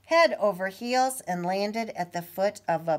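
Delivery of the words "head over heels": ordinary delivery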